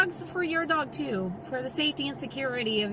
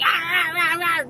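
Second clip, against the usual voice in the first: loud, higher mock barking yaps; imitated dog snapping; 4 semitones higher, 5 dB louder than usual. Beagle trying to bite the other dog, imitated barks. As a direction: snap like a dog going to bite. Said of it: "trying to bite the dog".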